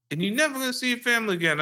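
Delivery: high, fast mock threat; mocking dad. Dad threatening his son with losing family, high and fast. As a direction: rattle off the father's threat. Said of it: "He's like".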